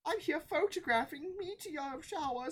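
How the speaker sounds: high, lilting Southern voice; friendly Southern woman. Acted. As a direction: introduce yourself with a high Southern twang.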